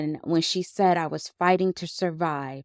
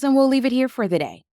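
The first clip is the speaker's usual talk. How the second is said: mimicking the therapist; 7 semitones higher, much faster than usual. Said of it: "And then she goes".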